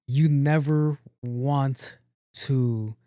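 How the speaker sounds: very slow, drawn-out warning; acted warning, slow and spaced out; deliberate warning. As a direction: stretch out each word of the warning. Her quoted warning, drawn out.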